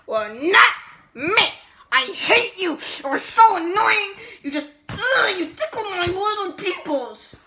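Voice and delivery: put-on loud childish tantrum; angry ranting. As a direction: yell like a bratty kid. Angry rejection of Mario.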